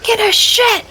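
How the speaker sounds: high, strained mock shriek; mock yell. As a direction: holler it in a silly high voice. Continues the acted yell for Aaron.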